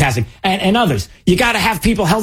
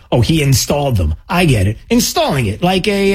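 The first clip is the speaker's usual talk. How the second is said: ordinary talk, nothing marked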